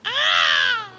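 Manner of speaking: put-on high, loud babyish squeal; mock high squeal. Streamer hamming up fear, high babyish squeal. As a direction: let out a silly baby shriek. Mock baby whine ends in scream.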